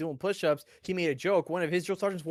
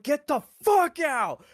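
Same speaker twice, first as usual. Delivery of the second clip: much higher, louder, slow scream; screaming drill sergeant; 11 semitones higher, 7 dB louder, slower than usual. Retold or imitated. Sergeant storming the bus screaming at recruits, loud yell. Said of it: "jumped into the fucking bus and was like".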